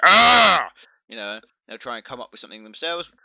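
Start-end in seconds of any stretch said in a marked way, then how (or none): 0.0-0.7 short high yell of frustration; frustrated yell. Retold or imitated. A taunted enemy player lets out a frustrated yell. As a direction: blurt out an exasperated ah, much higher and louder. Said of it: "they're like".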